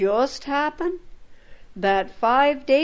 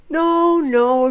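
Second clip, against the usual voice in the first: put-on a loud, higher no, no from the frightened old woman; old woman refusing, higher and louder; anxious refusal; 6 semitones higher, 5 dB louder than usual. A narrator's reading. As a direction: push her refusal out in alarm.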